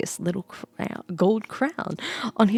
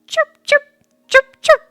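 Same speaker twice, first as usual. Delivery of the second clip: very high, loud chirping; bird chirps; 12 dB louder than usual. A narrator's reading. Swallow sings to the storyteller, very high chirps. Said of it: "the bird sang".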